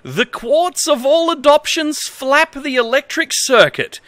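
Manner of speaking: reads broken manual text in a lightly formal voice; straight-faced reading of nonsense instructions; mock-earnest manual reading. Reads bad manual translation aloud.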